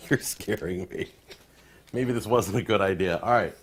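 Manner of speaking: ordinary delivery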